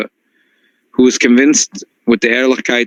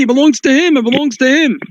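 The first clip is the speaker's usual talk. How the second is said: high, insistent repeat; insistent plea; 12 semitones higher, faster than usual. Retold or imitated. Rabbi insisting to the crowd the money is the thief's. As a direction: keep pleading high that it's his.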